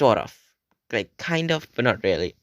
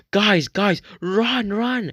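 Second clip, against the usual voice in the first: panicked shout; 10 semitones higher, slower than usual. Retold or imitated. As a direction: shout at them to flee, pitched way up. Friend fleeing store owner yells.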